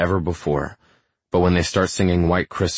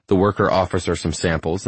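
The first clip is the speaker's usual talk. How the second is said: ordinary delivery, nothing marked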